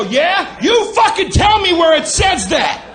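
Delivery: high, loud, furious yell; angry shout. Retold or imitated. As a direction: yell at the cashier in a rage. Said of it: "And I go".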